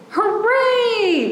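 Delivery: high bright cheer; cheering hooray. Acted. Sailors cheering as the sub docks, hammed-up cheer. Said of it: "the sailors shout".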